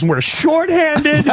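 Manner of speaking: very high, drawn-out whine; cop having meltdown. Acted. Cop complaining the force is shorthanded, whining meltdown. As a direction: stretch it into a high wail. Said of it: "He starts having a meltdown".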